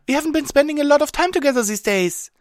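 very high, fast, loud squeak; squeaky cartoon pony voice; disappointed Pinkie Pie. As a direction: squeal the complaint quickly. Pinkie Pie's character voice.

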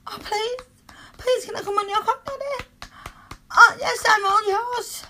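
high, pleading and breathy; breathless pleading. Acted. Lover pleading with partner, high and breathy. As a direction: beg in a high voice.

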